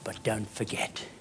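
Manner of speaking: soft, low, measured address with pauses; sincere gentle advice. Real, not acted. Speaker to the kids, closing moral, gentle and sincere. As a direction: bring it right down, low and tender.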